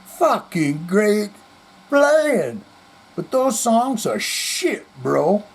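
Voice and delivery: put-on slow, dragging drunk put-down; slurred drunk insult. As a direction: stretch it out like a drunk musician sneering. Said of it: "He goes".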